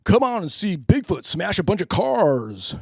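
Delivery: booming monster truck ad, slow. Acted. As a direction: shout it like a rally promo. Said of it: "it just comes on".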